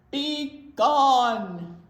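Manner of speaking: loud bear command; roaring. Acted. Bear roaring at the mouse to leave. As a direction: banish him with a roar. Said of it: "roared the bear".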